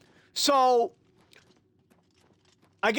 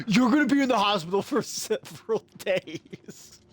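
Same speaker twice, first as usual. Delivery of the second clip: laughing imitation. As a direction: quote the brother while cracking up. Cracks up quoting his brother.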